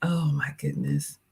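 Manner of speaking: breathless whispered gasp; awed whisper. Real, not acted. Streamer overwhelmed by viewer's gift, whispering.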